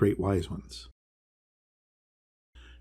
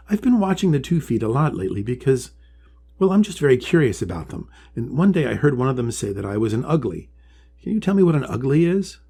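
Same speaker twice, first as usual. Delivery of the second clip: higher, eager little turtle voice; curious young Polly; 4 semitones higher, 4 dB louder than usual. Acted. Polly asking the wise one about the two feet, high and curious. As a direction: ask it wide-eyed and hopeful.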